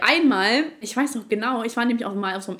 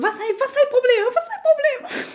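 shrill, high, loud mock outrage; mocking the girl; 5 dB louder than usual. Mimicking the rival girl's indignant repeated question, high and loud. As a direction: ham up the girl's indignant squeal.

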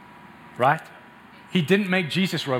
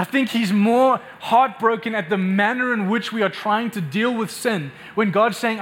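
rising preaching; 4 semitones higher than usual. Acted. Preacher pressing the church about sin, rising fervour. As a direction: preach it with growing fire. Preacher builds up to full force.

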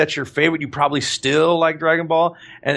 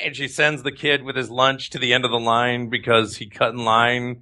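ordinary talk

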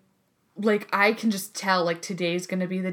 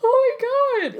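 near tears; 6 dB louder than usual. Retold or imitated. About to cry.

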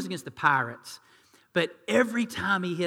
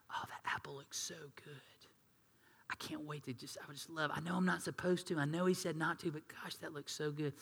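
hushed, longing whisper over forbidden fruit; tempted whisper; 9 dB quieter than usual. Acted. Eve longing for the forbidden fruit, whispered. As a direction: breathe it like a secret craving.

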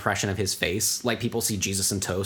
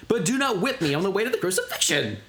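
higher-pitched mock protest; indignant; 7 semitones higher than usual. Co-host acts Jesus protesting the whipping, indignant. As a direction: object firmly, in a raised pitch. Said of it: "he got real indignant".

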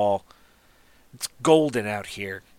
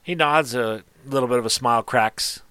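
normal talking voice

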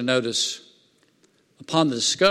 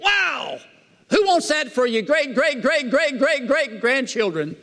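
high-pitched preacher in full flow, stretching the key words; fired-up preaching; 11 semitones higher than usual. Real, not acted. Preacher asking the congregation about David's blessed heritage, fired-up preaching. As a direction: preach it high, drawing out the big phrases.

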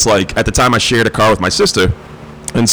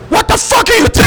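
high incredulous shout at a stranded friend; yelling a mock-angry what are you doing; shouted quote. Friend pulls up in the rain, shouting out in disbelief. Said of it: "and you're like".

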